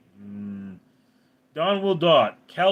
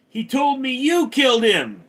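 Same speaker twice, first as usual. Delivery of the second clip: put-on high, louder young Luke; Luke's voice; 12 semitones higher, 6 dB louder than usual. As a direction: pitch up as an upset Luke. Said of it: "And Luke goes".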